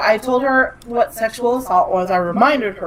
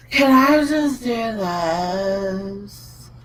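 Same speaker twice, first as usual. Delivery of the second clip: very slow, stretched-out question; drawn-out imitation; much slower than usual. Daughter asks mother something, slow drawn-out mimicry. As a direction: drag the words out as a mimicked kid.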